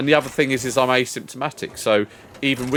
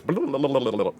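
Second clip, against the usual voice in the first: babbled tongue-flapping noise; quick burbling mock heartbeat; heartbeat going nuts; 5 semitones higher, faster than usual. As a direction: imitate a haywire rhythm with rapid gibberish. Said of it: "just goes nuts".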